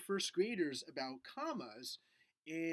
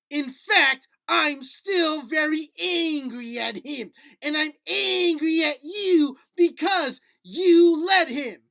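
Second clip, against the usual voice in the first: higher, louder, slow indignant puppet voice; puppet anger; 9 semitones higher, 17 dB louder, slower than usual. Acted. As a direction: puppet stamping its foot in mock fury. Puppet angrily refuses to forgive.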